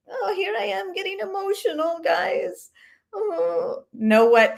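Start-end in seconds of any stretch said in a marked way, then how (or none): 0.1-2.7 high, breathy mock-tearful voice; mocking fake emotion. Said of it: "it's turned into this, like". 3.1-3.9 high mocking oh; hammed-up sigh of fake sadness; mock emotional. Mocking her fake tears, high mock-sad oh. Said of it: "it's turned into this".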